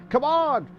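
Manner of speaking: high, loud shout of "come on"; urging shout. Real, not acted. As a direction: shout it high at a driver from the commentary box. Urging a driver on.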